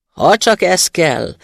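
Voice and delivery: slow, loud, proud drone; haughty spirit. A narrator's reading. Arrogant spirit agrees to shrink into the bottle: slow haughty boom. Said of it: "zúgta gőgösen a szellem".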